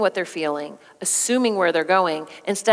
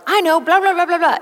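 high, dismissive blah blah; mocking dismissive; 9 semitones higher, 4 dB louder than usual. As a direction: brush off impatiently in a high voice. Mocking spouse interrupting.

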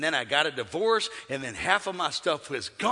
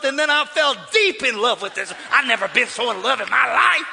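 loud, high preacher's shout; preaching at full force; 10 semitones higher, 10 dB louder than usual. Acted. Preacher shouting to the church over the organ. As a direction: whip the crowd up, loud and high.

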